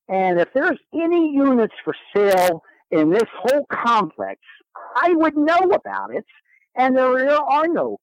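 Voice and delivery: a hint of a stern building president laying down the law; bossy building president. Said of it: "Very aggressive Chicago woman".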